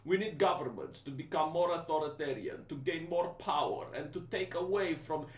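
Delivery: low, soft, unhurried captain's announcement; Schwab impression. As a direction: calmly announce a sinister plan like a pilot over the intercom. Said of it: "this is how he speaks".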